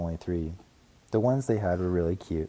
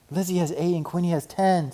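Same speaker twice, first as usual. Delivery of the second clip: put-on little girl voice; 10 semitones higher, 5 dB louder than usual. A narrator's reading. Sister cub voice.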